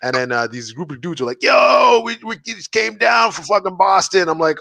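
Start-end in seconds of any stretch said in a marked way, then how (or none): 1.4-4.3 high, imitated shout on the Yo; hyped greeting. Group of dudes greeting Peter downtown, mock hyped shout.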